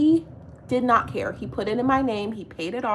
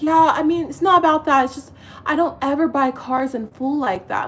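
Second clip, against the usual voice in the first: louder, higher mock of his defensive excuse; mock boyfriend excuse; 6 semitones higher, 6 dB louder than usual. Woman imitating boyfriend's excuse about the Tesla, mocking. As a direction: imitate him squirming.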